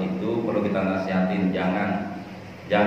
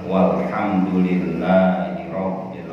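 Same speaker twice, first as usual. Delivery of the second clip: low, level Arabic prayer recitation; closing prayer; 5 semitones lower than usual. Real, not acted. Preacher leading the congregation in closing prayer, reverent. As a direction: recite evenly on a lowered pitch, slowing at the close.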